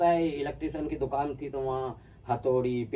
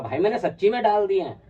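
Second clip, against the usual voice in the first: insistent retold shout; 6 semitones higher, 8 dB louder than usual. Friend insists the prank is real.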